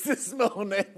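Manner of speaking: talking through real giggles; words broken by bursts of laughter; giggling. Real, not acted. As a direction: try to carry on while cracking up. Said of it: "have I just been giggling".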